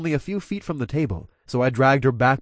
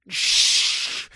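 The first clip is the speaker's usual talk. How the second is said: hushing. A narrator's reading. Hand over her mouth.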